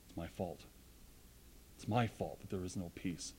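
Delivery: soft, low, owning the blame; hushed confession. Acted. Preacher confessing fault to the congregation, hushed. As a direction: drop to a near hush and admit it.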